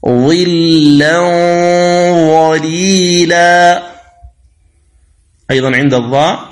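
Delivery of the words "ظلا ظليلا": ظلا ظليلا drawn out slowly, the tanween a heavy nasal hum before ظليلا; slow recitation of ظلا ظليلا; heavy nasal ghunna. Shown as a demonstration. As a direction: hold the ghunna long and full before ظليلا. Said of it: "التنوين".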